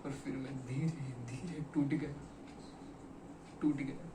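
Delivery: subdued, with halting repetition; broken admission. Acted. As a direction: let it sink quietly. Admits he slowly broke down.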